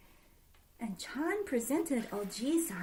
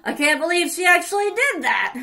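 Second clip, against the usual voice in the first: disbelief; 5 semitones higher, 8 dB louder, faster than usual. Acted. Cow man amazed.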